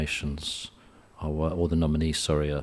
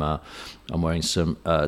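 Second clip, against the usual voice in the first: ordinary talk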